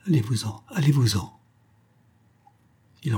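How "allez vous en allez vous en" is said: a hurried, cracked plea to leave; broken, strange murmur. A narrator's reading. Woman overwhelmed by love, urging him to leave in a broken voice. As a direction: voice breaking as she sends him away. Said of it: "une voix brisée et étrange, lui murmurait très vite".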